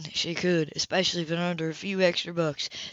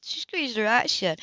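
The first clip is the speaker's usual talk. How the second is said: usual speaking voice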